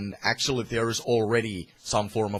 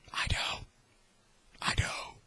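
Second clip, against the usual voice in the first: whispered aside; 8 dB quieter than usual. Acted. Aside after race remark.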